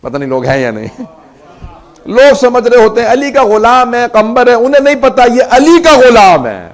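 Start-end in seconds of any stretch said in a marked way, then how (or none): 2.1-6.7 loud, higher impassioned cry, slowing into anguish; preaching at full force. Acted. Preacher voicing the weeping scholar to mourners, impassioned cry. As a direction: lament it to the crowd, voice pushed and aching.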